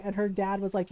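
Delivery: usual speaking voice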